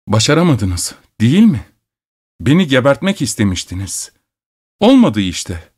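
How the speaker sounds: ordinary delivery, nothing marked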